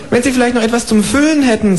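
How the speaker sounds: high, chirpy kangaroo asking for eggs. Acted. Kangaroo asking the neighbour for eggs, high and chirpy. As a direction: pipe up like a polite cheeky kangaroo. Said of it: "sagt das Känguru".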